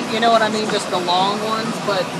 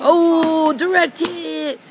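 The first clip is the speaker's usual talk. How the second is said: playful battle cry; 8 semitones higher, 4 dB louder, much slower than usual. Pretend. Pool noodle sword fight.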